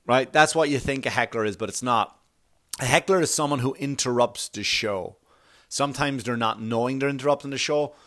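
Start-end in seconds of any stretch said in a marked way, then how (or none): none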